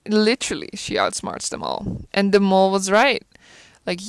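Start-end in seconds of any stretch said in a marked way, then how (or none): 0.7-2.1 "she outsmarts them all" whispered low and voiceless. Shown as a demonstration. Repeats the line for effect.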